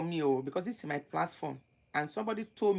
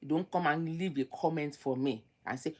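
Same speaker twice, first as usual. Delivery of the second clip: ordinary talk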